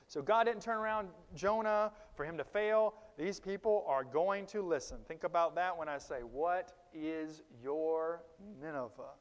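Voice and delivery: loud, rising preacher's call; fiery preaching. Acted. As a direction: preach it out to the congregation. Preaching at full force.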